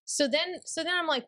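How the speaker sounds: ordinary delivery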